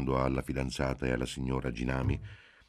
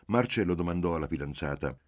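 ordinary delivery, nothing marked

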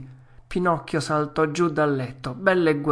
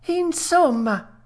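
high, drawn-out exasperated wail; tearful cry; 4 dB louder, much slower than usual. Acted. Puppet bursting into tears, high wail. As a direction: burst out, pitched high and slow. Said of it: "gridò Pinocchio, dando in uno scoppio di pianto".

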